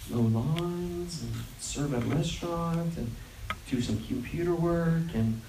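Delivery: low, soft, halting list; sheepish reply. Retold or imitated. Odd-job worker answering what he does, acted sheepishness. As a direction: admit it reluctantly, trailing along quietly.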